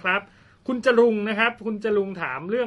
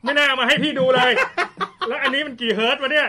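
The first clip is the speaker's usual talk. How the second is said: laughing; 5 semitones higher, 5 dB louder than usual. Real, not acted. Host regrets seeing 90 Hz.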